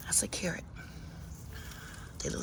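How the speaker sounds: whispered reveal of the carrot; soft whispered aside to viewers. Real, not acted. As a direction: hush it like sharing a secret. Repeats in whisper.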